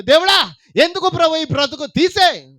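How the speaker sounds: high, loud anguished cry; despairing prayer. Retold or imitated. Preacher imitating a believer wailing to God in despair. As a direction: wail it up to God.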